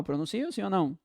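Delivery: ordinary delivery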